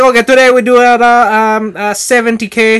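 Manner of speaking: loud, high-pitched imitation of an Italian coach; hammed-up Italian accent; thick Italian accent. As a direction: mimic an Italian coach announcing the plan, hesitating. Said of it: "he's got this thick Italian accent".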